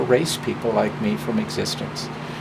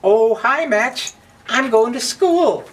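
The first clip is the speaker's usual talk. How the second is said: much higher, cheerful little voice; innocent Book; 11 semitones higher, 4 dB louder than usual. Acted. Book cheerfully telling Match about school, high and innocent. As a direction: chirp it out, unaware of trouble.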